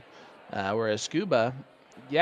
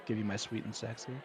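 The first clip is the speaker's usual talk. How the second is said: sultry voice; 2 semitones lower, 11 dB quieter, faster than usual. Pretend. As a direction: purr it like a perfume commercial. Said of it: "if you really wanna hear my sultry voice".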